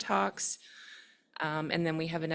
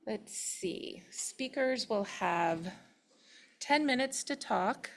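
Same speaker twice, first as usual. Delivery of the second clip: ordinary delivery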